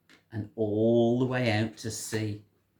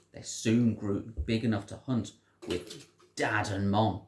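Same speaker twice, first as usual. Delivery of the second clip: plain reading voice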